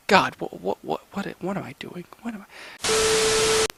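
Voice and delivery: muttering, trailing to a whisper; muttering to self. Real, not acted. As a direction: mumble it away to nothing.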